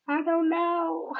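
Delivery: high, drawn-out comic wail; mock bawling. Answering family who found her bawling, mock tearful. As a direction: wail it slowly, imitating yourself crying. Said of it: "I'd be sitting there bawling and crying".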